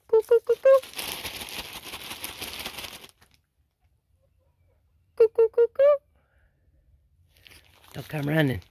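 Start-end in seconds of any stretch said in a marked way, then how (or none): 0.1-6.0 chicken call. Real, not acted. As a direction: sing it out high to call the hens. Calling chickens in for snacks.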